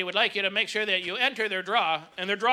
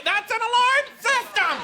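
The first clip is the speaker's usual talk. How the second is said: higher, slow, laughing yell; retold yell; 13 semitones higher, 3 dB louder, slower than usual. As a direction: bellow it a third time, cracking up. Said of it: "I had a lady yell out".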